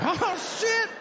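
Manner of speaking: shot up high, caught-out oh shit; sudden alarm. Retold or imitated. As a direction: yelp of dread. Realizing mother is angry.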